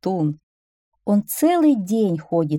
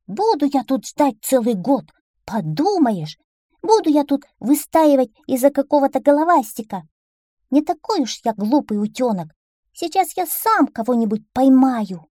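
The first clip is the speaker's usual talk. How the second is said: high, louder, indignant duckling voice; impatient little duckling; 7 semitones higher, 5 dB louder than usual. Acted. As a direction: grumble defiantly as a cocky little duckling. (нетерпеливый, возмущённый утёнок, высоким голосом)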